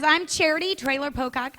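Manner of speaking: ordinary delivery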